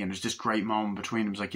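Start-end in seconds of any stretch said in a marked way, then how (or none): none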